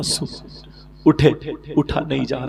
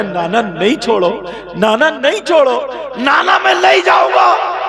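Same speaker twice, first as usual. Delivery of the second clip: from raised to high, loud shout; building child's plea; 11 semitones higher, 6 dB louder than usual. Acted. Husain begging his grandfather not to let go, building to a shout. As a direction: beg harder each time till shouting.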